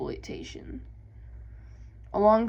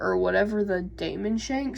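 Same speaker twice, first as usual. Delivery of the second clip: normal talking voice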